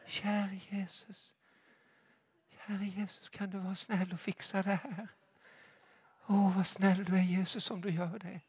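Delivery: mock our polite, hushed praying; mock timid prayer. Preacher mocking the church's timid prayers, meek and hushed. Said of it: "De kan inte det där med våra religiösa böner".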